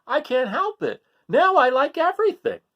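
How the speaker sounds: put-on high, eager kid goat; gushing that he now likes everything; Gregory's excited voice. Little goat son to his parents, eager and high. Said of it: "said Gregory".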